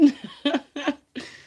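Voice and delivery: laugh. Real, not acted. Laughs at own joke.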